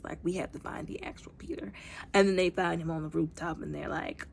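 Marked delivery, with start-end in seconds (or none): 0.0-1.7 hushed whisper. Retold or imitated. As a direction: whisper it like a secret. Quoted under breath.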